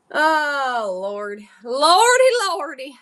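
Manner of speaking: hammed-up Southern lament, swooping high; mock-weary Southern old lady. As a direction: wail it like a Southern granny. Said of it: "my Southern voice is old lady".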